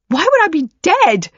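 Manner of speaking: shocked disbelief. Acted. Daughter shocked at accusation.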